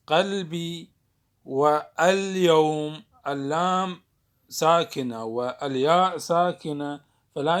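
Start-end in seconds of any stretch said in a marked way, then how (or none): none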